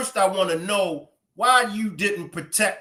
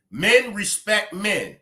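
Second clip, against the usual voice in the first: ordinary talk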